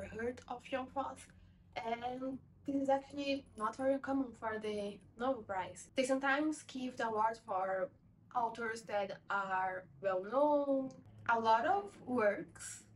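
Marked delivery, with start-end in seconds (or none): none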